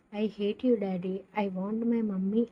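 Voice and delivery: plain reading voice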